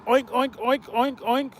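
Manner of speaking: soft mocking oinks; mock pig oinks. Calling Reg the wild pig, quiet mock oinks. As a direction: oink it softly, like a pig squealing to cops. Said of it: "He was the wild pig".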